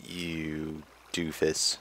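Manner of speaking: soft, slow muttered jab; muttered insult. Real, not acted. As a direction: mumble it under your breath.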